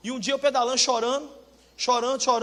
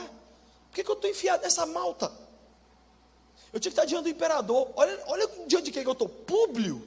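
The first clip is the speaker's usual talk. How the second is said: higher-pitched, indignant Paul grumbling at his fate; Paul complaining; 6 semitones higher than usual. Acted. Paul protesting to God about the island, high and indignant. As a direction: play Paul fed up, voice climbing to a cry. Preacher voicing apostle Paul's frustration.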